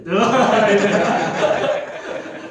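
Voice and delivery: loud, high long laugh. Real, not acted. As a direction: laugh hard. Big laugh. (tertawa keras panjang)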